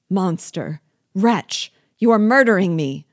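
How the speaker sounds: building to a loud, high cry; enraged outcry. A narrator's reading. Unhappy man at the dagger's point, enraged. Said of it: "in his rage".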